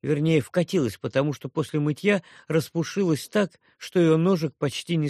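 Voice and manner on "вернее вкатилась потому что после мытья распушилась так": usual speaking voice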